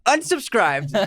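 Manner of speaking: high, drawn-out mock verdict; teasing sign-off. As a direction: pronounce the verdict like a game-show host. Jokey sign-off on a comment.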